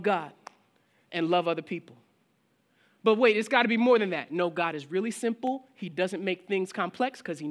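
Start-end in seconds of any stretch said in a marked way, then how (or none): 3.0-4.3 fast, louder objecting believer; doubting congregant. Retold or imitated. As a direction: push back quickly, unconvinced.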